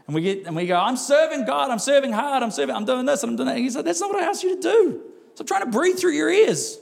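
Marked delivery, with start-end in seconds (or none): 0.8-3.6 high, piled-up list of boasts; hammed-up strained protest of busyness; mock busy zeal. Believer telling God all they are doing, mock frantic zeal. 3.8-6.8 very high, mock exasperated scolding; exasperated rebuke. God answering the overbusy believer, comic exasperation.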